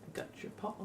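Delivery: soft unvoiced whisper; hushed whisper to a pet. Real, not acted. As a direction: murmur it softly to the pet. Talking softly to the pet.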